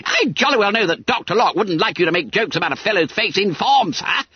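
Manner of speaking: high, rapid schoolboy protest; indignant. A narrator's reading. Bunter protests to the master about jokes, indignant. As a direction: rattle off a squeaky complaint. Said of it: "exclaimed Bunter indignantly".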